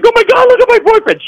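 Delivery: very high, fast hysterical wail; mock crying girlfriend. Girlfriend of the bleeding man, mock hysterical wailing. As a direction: imitate a distraught girlfriend crying out. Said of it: "She's crying".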